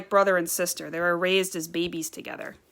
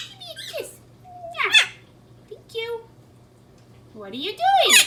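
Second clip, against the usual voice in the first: talking to a bird; 8 dB quieter than usual. Real, not acted. High-pitched talk to pet birds.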